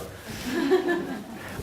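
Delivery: high, soft embarrassed laugh; emotional laugh. Real, not acted. Speaker moved by friends in front row, soft laugh. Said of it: "I'm not sure why I'm getting emotional".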